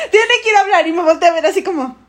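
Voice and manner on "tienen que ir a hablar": higher, laughing mock teacher; mock stern teacher. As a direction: lay on the teacher's urging. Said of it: "Yo mando aquí".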